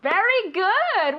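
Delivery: high, slow, stretched-out praise; enthusiastic praise. Real, not acted. Teacher rewarding a corrected answer, enthusiastic. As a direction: draw out the praise, pitch lifted.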